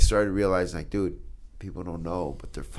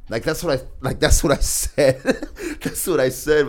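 laughing; 6 semitones higher, 8 dB louder than usual. Real, not acted. Laughing retelling his victory.

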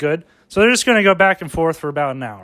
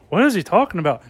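ordinary delivery

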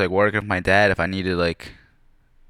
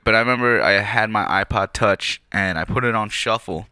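ordinary talk, nothing marked

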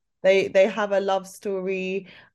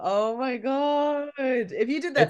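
mock swoon; 6 semitones higher than usual. Teasing swoon over lyric.

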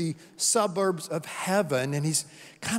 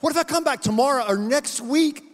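much higher and louder, anxious stalling; a ghost begging the angel for more time; bargaining ghost; 8 semitones higher, 5 dB louder than usual. Acted.

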